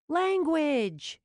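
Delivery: high, loud scolding snap; sharp snap. Acted. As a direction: snap it out as a rebuke. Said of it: "Jules snapped".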